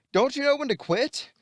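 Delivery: high-pitched heroic taunt; Spider-Man. Acted. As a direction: pitch it way up, mock exasperation. Voicing Spider-Man jumping in on Electro.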